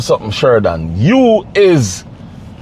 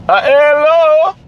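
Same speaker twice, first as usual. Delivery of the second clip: calling out; 12 semitones higher, slower than usual. Real, not acted. Calling out to a man.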